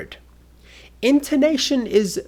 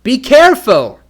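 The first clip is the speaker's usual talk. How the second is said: loud, high warning cry; exclamation; 9 semitones higher, 10 dB louder than usual. Shown as a demonstration. Teacher demonstrating an exclamation, loud high warning. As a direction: call out "Be careful" urgently. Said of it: "For an exclamation".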